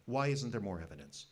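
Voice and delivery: usual speaking voice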